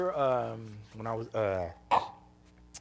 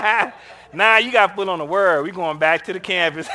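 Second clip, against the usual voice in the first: put-on louder, laughing dismissal of the kids; loud laughing refusal; 3 semitones higher, 13 dB louder than usual. Counselor refusing kids a restaurant trip, loud and laughing. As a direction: brush them off with a big laugh.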